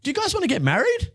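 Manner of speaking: high, put-on voice asking the kids; goofy cousin voice. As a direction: play the cousin as a silly, squeaky officiant. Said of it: "and he's like".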